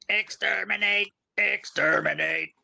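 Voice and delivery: lightly voiced robotic Dalek; a hint of a Doctor Who Dalek, drawn out. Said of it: "You sound like a dialect".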